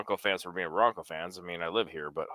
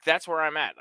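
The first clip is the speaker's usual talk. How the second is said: normal talking voice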